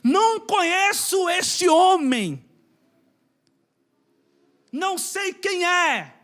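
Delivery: Peter's denial. Retold or imitated. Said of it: "é o que Pedro fez".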